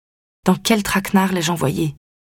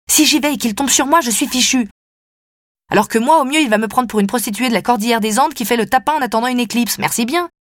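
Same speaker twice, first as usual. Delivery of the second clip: indignant; 4 semitones higher than usual. Acted. Sophie refuses, indignant.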